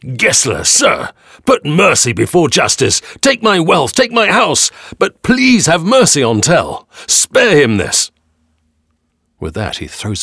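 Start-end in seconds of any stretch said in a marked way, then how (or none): 0.0-8.1 high, loud desperate plea slowing at the end; old man begging for mercy; blurting out. A narrator's reading. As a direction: beg the lord at full voice. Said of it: "he suddenly blurts out".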